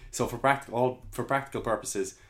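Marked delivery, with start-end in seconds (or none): none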